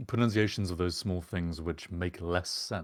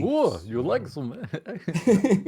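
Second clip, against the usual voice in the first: high, slow mock Russian accent; 10 semitones higher, much slower than usual. Teasing the co-host's accent attempt, in mock Russian. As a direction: drag out a jokey Russian accent. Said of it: "No, this is Russian".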